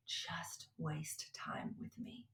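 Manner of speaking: soft, slow whispered reassurance; tender whisper, slow and loving; God gently inviting her to slow down, whispered; gentle voice of God. Retold or imitated. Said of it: "the Lord just so gently was like".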